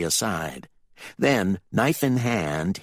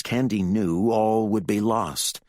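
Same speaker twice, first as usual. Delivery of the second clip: plain reading voice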